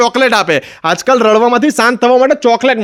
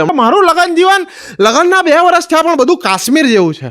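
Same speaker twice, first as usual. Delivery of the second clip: smug husband; 7 semitones higher than usual. Retold or imitated. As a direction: boast about his marriage. Married friend describes his marriage.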